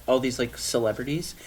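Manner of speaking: usual speaking voice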